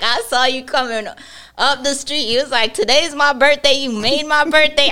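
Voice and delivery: a hint of a delighted old man gushing. Telling the mail carrier she made his birthday, mock old man.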